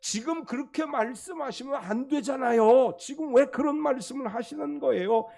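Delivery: protesting. Retold or imitated. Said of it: "항변이라고 하는 말은 비난하다는 뜻이에요".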